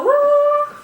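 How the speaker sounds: high, drawn-out "woo" like a whistle; imitated whistle woo. Friend mimicking the whistles from a video, high drawn-out whoop. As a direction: sing out a loud, rising woo. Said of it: "The whistles go".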